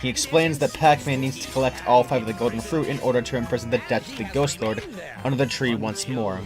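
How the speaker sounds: plain reading voice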